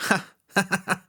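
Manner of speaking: high, voiced ha-ha laugh; tavern keeper's guffaw. A narrator's reading. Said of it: "s'exclaffa le tavernier". (éclat de rire du tavernier)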